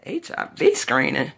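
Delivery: slow, drawn-out questioning of "screening"; incredulous echo. Retold or imitated. Patient's wife questions the doctor's test order, incredulous. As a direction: repeat "H I V screening" slowly as a disbelieving question.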